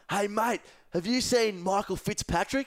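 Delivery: calling out to an imaginary mate; calling across the room. Retold or imitated. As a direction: shout across a party room. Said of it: "pretended my friend was over there".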